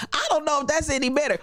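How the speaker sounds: ordinary delivery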